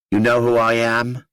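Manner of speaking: put-on light Boston accent, blunt question; a young girl's Boston accent. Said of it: "she asked in a Boston accent".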